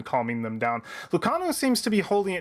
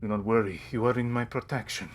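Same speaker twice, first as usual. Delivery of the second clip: low, softer protective captain; consoling; 4 semitones lower, 4 dB quieter than usual. Acted. Captain to the frightened merchant she protects, consoling. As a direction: reassure in a calm, lowered voice. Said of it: "consoling him".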